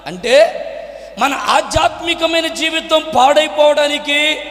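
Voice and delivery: high, insistent sermon delivery; impassioned preaching. Real, not acted. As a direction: press each question on the congregation.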